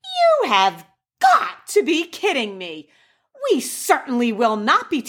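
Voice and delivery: high-pitched imitation of an indignant mom; hammed-up, exasperated motherly protest; incredulous mother. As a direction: refuse in mock outrage, swooping up high. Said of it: "my mother turns to me".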